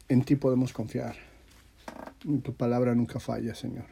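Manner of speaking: ordinary talk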